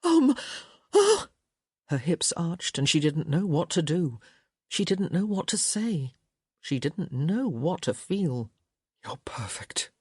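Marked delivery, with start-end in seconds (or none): 0.0-1.3 high, loud surprised cry; startled gasp. A narrator's reading. Woman startled by her lover's touch, surprised cry. Touched intimately for first time. 9.0-9.9 soft low whisper into her ear. A narrator's reading. Man whispering into his lover's ear.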